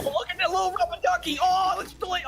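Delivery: excited. Real, not acted. Excited at rubber ducky.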